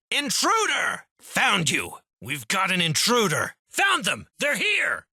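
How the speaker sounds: sudden high alert cry; alarmed calling out. Acted. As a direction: call the guards, you've spotted someone. Guard spotting an intruder.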